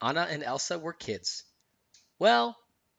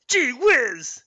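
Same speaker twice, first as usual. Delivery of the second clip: high, loud put-on reindeer voice; goofy cartoon creature voice; Sven; 9 semitones higher, 6 dB louder than usual. A narrator's reading. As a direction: exclaim as a talking animal, high and loud. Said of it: "in Sven's voice".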